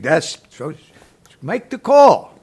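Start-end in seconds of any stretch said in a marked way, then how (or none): 1.4-2.4 a sudden loud barked command; loud warden's command; loud order. Retold or imitated. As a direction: bark it like the warden giving an order. Warden ordering him to phone.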